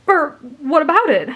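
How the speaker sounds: a touch of nervous panic, pitch jumping up; mild panic. A narrator's reading. Boy questioned about the other girl, mildly panicked. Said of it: "He began to panic".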